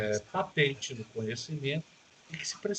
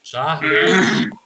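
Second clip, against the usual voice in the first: much louder name, then the question; calling out loudly; 3 semitones higher, 12 dB louder than usual. Real, not acted. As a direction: shout his name across the line. Repeats check on silent call.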